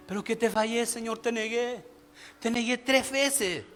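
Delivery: soft, acted remorse; hushed plea, drawing out the last words; penitent confession. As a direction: confess it quietly to the Lord.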